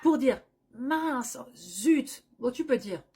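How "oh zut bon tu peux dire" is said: usual speaking voice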